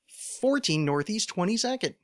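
exaggerated show-voice plug; weird show-intro voice. Shown as a demonstration. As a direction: say the address in a silly high voice. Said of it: "I say it in that voice".

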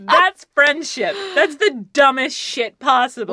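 loud, high, laughing-crying voice. Retold or imitated. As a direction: laugh through the words. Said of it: "And I'm like".

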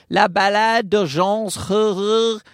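slow attempt at a French accent; drawn-out French song title in a French accent. Shown as a demonstration. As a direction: put on a careful French pronunciation. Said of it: "right there is a perfect French accent".

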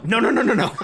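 high-pitched string of refusals; dismissive cutoff. Acted. Movie cutting off the viewer's question, mock-dismissive. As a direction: shut the question down in mock urgency.

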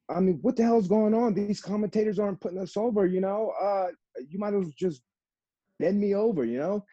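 high-pitched, worked-up retelling of a furious outburst; furious complaint. Retold or imitated. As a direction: replay storming up to the boss to complain, pitched high. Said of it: "And I was furious".

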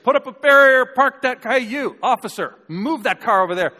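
loud, high barked commands, half laughing; mock bossy orders. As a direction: order the crowd about like a self-made chief, hammed up. Preacher mock-orders police at event.

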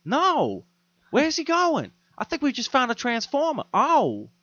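very high, bewildered put-on voice; Mark Wahlberg. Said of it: "even Mark Wahlberg was like".

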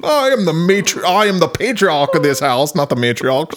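hammed-up Southern patriarch accent. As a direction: declare it as a mock Southern patriarch. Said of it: "keep talking like that".

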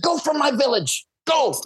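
high commanding cry; mock shout. Chief ordering border patrol out, high mock shout. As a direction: drive them out like a chief. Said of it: "Kuna are famous for shouting".

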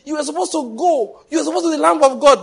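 high, loud, quick scolding voice; mocking critic. Preacher mimicking churchgoers criticizing Peter, high and loud. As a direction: needle him as a self-righteous critic.

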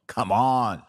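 ordinary delivery

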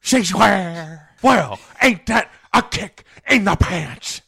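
loud, high hammed-up Joker; mock Joker. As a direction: ham up the villain's indignant reply. Joker voiced reacting.

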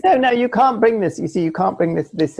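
high, insistent protest; heated objection. Real, not acted. Co-host objects to editing being brought up, high and insistent. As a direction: jump in high and forceful to object.

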